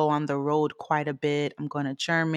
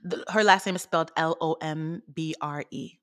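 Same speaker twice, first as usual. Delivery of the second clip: ordinary delivery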